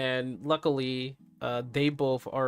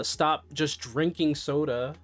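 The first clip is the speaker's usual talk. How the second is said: ordinary talk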